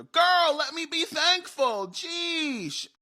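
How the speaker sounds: high, loud mock exasperation; exasperated outburst. Aunt interrupted mid-bite by her niece, exasperated outburst.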